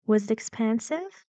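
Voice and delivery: teacher modelling a rising question on "expansive"; rising intonation. Shown as a demonstration. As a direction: lift the tune from PAN in "expansive". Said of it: "Rising, exactly".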